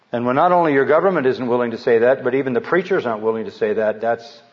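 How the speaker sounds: ordinary talk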